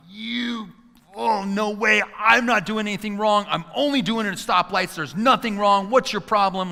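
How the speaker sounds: high, indignant retort; screaming back. Retold or imitated. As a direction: act out yelling back at another driver. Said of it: "I'm screaming back at him".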